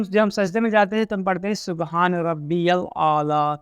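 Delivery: ordinary talk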